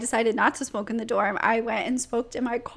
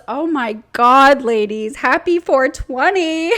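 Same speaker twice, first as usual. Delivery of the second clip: loud, slow, gushing hostess greeting; mock hostess; 8 dB louder than usual. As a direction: draw it out like an overeager hostess. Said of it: "the hostess just goes".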